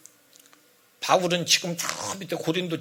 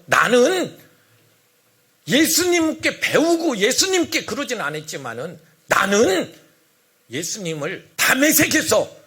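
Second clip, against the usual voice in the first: loud, emphatic self-defence; heated, forceful testimony; angry apostle; 3 semitones higher, 4 dB louder, slower than usual. Acted. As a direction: insist on your credentials, hitting the key words hard. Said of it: "화가 났어요".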